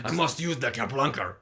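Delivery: hammy, fake German accent; German accent, badly faked. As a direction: put on a clumsy German accent. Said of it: "That's a really bad German accent".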